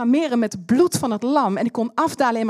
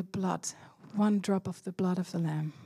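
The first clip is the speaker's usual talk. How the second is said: breathy whispered answer of God; soft, intimate whisper of the divine; 4 semitones lower, 10 dB quieter than usual. Retold or imitated. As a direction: hush it, barely voiced, like a secret. God's answer, quoted.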